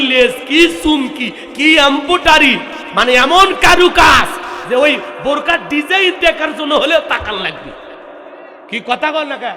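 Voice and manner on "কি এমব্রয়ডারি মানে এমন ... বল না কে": normal talking voice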